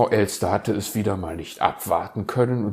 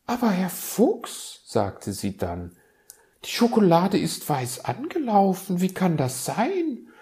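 much higher, doubtful magpie voice; sceptical magpie; 11 semitones higher, 3 dB louder than usual. Acted. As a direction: question the stale treats like a prim bird. Elster skeptisch zum Fuchs. (skeptische Elster, viel höher)

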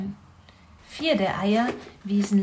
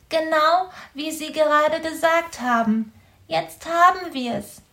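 a much higher, sulky little voice; sullen hedgehog; 7 semitones higher, 3 dB louder than usual. A narrator's reading. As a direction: grumble it back at him. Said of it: "sagte Borstel verdrießlich".

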